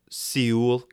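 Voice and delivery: Seoul said as "SEE-ool"; mock mispronunciation. Introducing where he is, mock-mispronouncing Seoul as "see-ool". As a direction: stretch Seoul into two syllables, "see-ool". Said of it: "It is pronounced Seoul".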